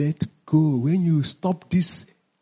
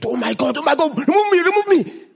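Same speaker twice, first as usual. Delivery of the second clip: higher, louder mock-pleading plea; hammed-up cry from the hot pot; a lightly voiced panicking rice grain; 7 semitones higher, 3 dB louder than usual. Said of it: "he begins to cry".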